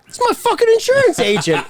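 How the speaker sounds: imitated high-pitched excited reply; retold excited outburst. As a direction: blurt it out like a delighted discovery. Retold excited discovery of mutual acquaintance.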